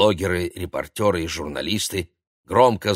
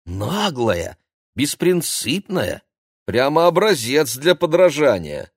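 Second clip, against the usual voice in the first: high-pitched teasing, building louder; giggly teasing; 7 semitones higher, 5 dB louder, slower than usual. A narrator's reading. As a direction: tease with mock admiration. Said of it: "хихикнула Кира".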